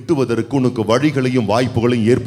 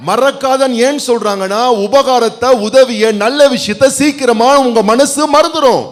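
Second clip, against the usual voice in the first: high, loud pulpit preaching; full-force Tamil sermon voice; fiery preaching; 11 semitones higher, 9 dB louder than usual. Acted. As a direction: preach it out to the whole church.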